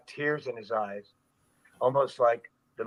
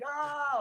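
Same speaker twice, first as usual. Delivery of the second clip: imitated scream; 13 semitones higher, 4 dB quieter than usual. Stuntman showing onlookers how he screamed, mock screaming. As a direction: act out a falling scream at contained volume. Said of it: "I'm screaming".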